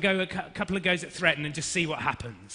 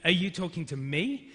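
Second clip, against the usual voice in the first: ME stressed with a rise. Shown as a demonstration. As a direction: save the weight for the final "me". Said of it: "maybe you changed the emphasis".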